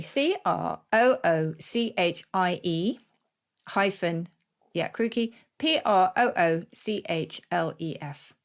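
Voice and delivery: letters spelled one by one, slowly; spelling out. Shown as a demonstration. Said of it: "Say it again".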